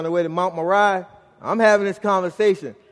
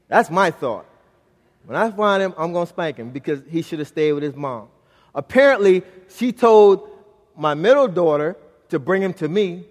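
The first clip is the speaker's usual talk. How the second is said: ordinary talk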